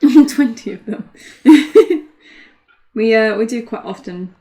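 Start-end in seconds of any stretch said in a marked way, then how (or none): 0.0-2.1 laughing echo, louder. Real, not acted. Echoing a co-host's joke about Worlds, laughing. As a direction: repeat it through a real laugh.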